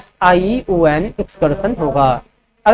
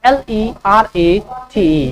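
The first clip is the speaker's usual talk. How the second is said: slow letter-by-letter dictation; slow spelling dictation; spelling out slowly; much slower than usual. Shown as a demonstration. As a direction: spell each letter clearly for students. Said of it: "कैसे होगा accelerate?".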